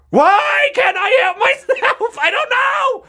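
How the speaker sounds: ordinary talk, nothing marked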